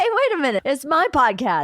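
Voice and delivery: high, indignant mock protest; playfully offended; mock outrage. Mock indignant podcast skit.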